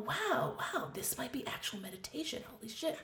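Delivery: high, hushed wonder; retold awe. Meditator finding joy in kids' laughter, hushed awe. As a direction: half-whisper it in dawning amazement.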